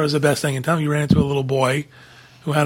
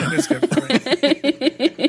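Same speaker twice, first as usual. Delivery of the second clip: laughing; 11 semitones higher, much slower than usual. Real, not acted. Laughing, drawn-out agreement.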